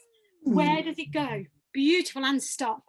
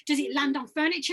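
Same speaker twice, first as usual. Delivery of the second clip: ordinary delivery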